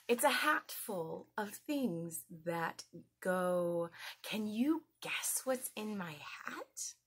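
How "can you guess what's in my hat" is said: soft secretive whisper. Acted. Secretive question to kids.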